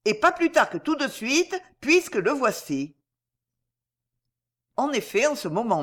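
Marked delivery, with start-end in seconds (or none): 0.0-2.9 higher-pitched, determined voice; resolute. A narrator's reading. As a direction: press on with resolve, pitch raised. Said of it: "fit-elle résolument". (résolument, voix plus aiguë)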